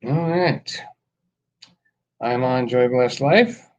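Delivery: ordinary delivery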